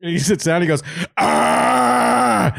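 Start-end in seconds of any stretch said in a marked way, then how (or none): 1.2-2.5 long high mock scream; imitated ice-bath shriek; freaked-out scream. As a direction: cry out high as if the cold just hit. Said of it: "he's, like, freaking out".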